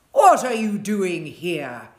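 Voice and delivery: slow, loud, gruff giant voice; a giant barking at trespassing children. A narrator's reading. Giant shouting gruffly at children in his garden. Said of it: "he cried in a very gruff voice".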